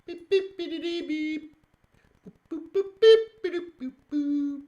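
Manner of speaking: high squeaky beep-boop noises; mouthed keypad beeps. Real, not acted. Mouthed beeps while working chart.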